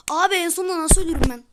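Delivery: loud, fast frustrated complaint; annoyed gamer blurting it out quickly; exasperated outcry. Real, not acted. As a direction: rant at dying right at the end. Dies at the end of course.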